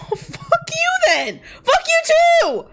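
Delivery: high, loud, slow screaming comeback; yelled insults. Acted. Two friends trading insults, screaming at each other. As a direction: scream it back at him. Acted quarrel between friends.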